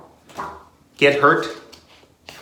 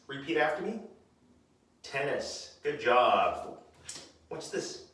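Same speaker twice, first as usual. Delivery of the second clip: ordinary talk, nothing marked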